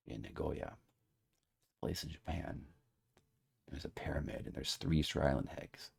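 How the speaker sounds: hammed-up conspiratorial hush; mysterious whisper. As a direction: whisper like sharing a deep mystery. Mysterious pondering to viewers.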